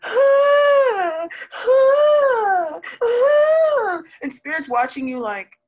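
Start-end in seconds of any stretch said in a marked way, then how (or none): 0.0-4.0 high, loud, hammed-up crying wail; mock wailing. Mocking the reader, a loud fake cry of shock. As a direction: overblown sob of shock, voice cracking. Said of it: "you're like".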